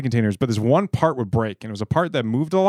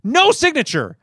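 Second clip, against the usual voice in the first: indignant rant; 11 semitones higher, 3 dB louder than usual. Real, not acted. Ranting about rude company.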